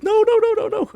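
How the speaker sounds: high rapid-fire string of panicked no's; panicked pleading. Real, not acted. Player fearing his character's death, panicked. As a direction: blurt it out in real alarm, very high. Panics during a dangerous game attack.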